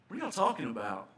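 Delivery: put-on light Southern US accent. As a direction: ask it with an easy Southern accent. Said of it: "Jesus with a southern accent".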